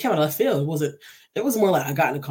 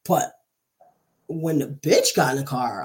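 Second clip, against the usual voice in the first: ordinary delivery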